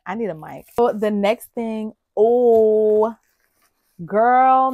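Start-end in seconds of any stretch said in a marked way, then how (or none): 2.2-4.7 loud, high overwhelmed cry; surprised. Real, not acted. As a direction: gasp it out, stunned. Overwhelmed gift reaction.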